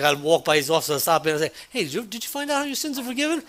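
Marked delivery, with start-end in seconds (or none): 1.7-3.4 quick, high, breezy jab in passing; playful teasing. Retold or imitated. To a coworker while passing his office, teasing and quick. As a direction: toss it off lightly as you walk by.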